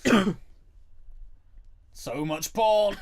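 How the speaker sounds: mock character line. Joking over the film, slow and loud. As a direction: drag it out in a silly voice.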